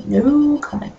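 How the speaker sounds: ordinary delivery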